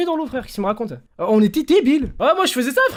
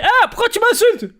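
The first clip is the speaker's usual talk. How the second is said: brother's puzzled voice; 11 semitones higher, 5 dB louder than usual. Retold or imitated. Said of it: "il fait".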